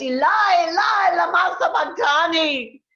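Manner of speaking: crying out high and loud in anguish. Acted. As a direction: call out to God from the cross. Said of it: "Jesus cried with a loud voice".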